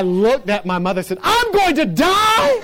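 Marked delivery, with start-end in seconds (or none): 1.2-2.6 loud, very high drawn-out wail; mock panic. Injured boy to his mother, hammed-up panicked wail. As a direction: imitate a child screaming in terror.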